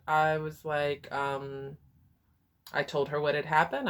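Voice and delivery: ordinary talk, nothing marked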